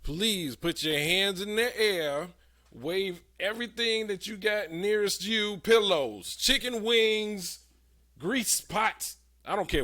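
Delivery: booming motivational speech. Real, not acted. Host rallies listeners to wave things.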